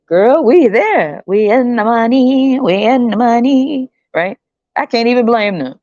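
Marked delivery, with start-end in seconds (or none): 0.1-3.9 high, slow singsong gloat; gloating women. Retold or imitated. Women telling each other they're in the money, high slow singsong. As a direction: crow over the win, drawn out.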